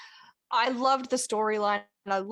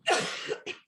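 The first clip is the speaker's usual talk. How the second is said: breathy coughing fit. Real, not acted. Still choking on drink.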